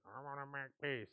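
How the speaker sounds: mock half-hearted peace offering; insincere apology. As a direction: imitate a kid who doesn't mean it. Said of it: "Don't say it like that".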